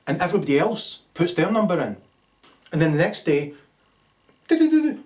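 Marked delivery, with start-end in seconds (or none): 4.5-5.0 imitated phone jingle. Phone shows the result.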